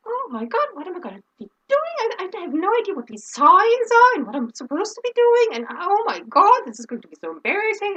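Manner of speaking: high, rising inner panic, retold; mock panic. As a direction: fret as if stranded on live TV. Retold inner panic on live set.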